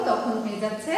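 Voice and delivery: usual speaking voice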